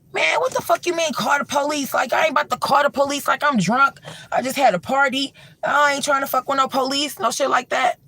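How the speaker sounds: high, fast dismissive rant; drunk refusing host. Retold or imitated. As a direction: wave off the police idea. Drunk Dre refusing police.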